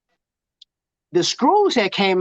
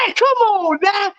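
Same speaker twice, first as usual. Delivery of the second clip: high, slow, drawn-out praise; excited; 12 semitones higher, slower than usual. Real, not acted. Hyping up a friend's wedding photo, excited. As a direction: stretch it out in delight.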